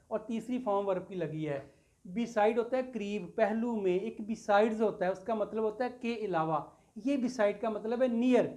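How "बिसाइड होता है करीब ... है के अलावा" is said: ordinary delivery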